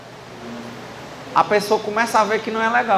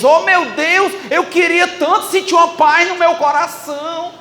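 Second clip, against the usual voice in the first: fiel suplicando; 8 semitones higher, 3 dB louder than usual. Retold or imitated. Imita fiel pedindo paz.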